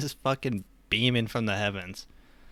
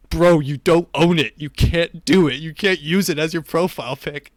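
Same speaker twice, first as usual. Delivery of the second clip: loud, higher-pitched mock outrage; retold indignant protest; 6 semitones higher, 10 dB louder than usual. Commenter calling out an NFT thief, loud mock indignation.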